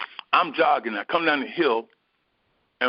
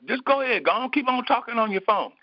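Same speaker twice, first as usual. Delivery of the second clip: much higher, mocking go-ahead; sarcastic dismissal; 11 semitones higher than usual. Jogger dismissing the distracted mail carrier, sarcastic. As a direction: wave her off with sarcasm. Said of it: "I was angry".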